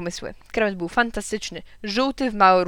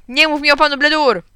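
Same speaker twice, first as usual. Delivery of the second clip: higher, loud angry refusal; furious shout; 6 semitones higher, 8 dB louder than usual. A narrator's reading. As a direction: yell that you won't hear it. Father shouts at mother.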